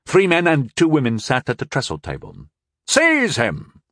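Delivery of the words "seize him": high, ringing command; a young captain ordering the seizure; sharp order. A narrator's reading. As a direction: order the arrest. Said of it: "Walvin ordered".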